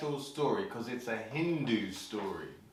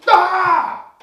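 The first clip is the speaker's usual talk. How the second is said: very loud, high "Ah!"; straszący okrzyk; 16 dB louder than usual. Acted. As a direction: jump out and scare someone. Shiva jumps out at Parvati.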